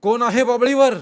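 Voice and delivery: high, full-voiced challenge; loud shout. A narrator's reading. Rama yells at whoever is up the acacia, loud shout. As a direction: yell up at someone in a tree. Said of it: "तो जोरात ओरडला".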